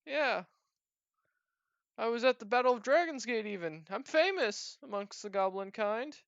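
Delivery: high-pitched goblin bragging; boastful goblin. Acted. Goblin boasts of his fame.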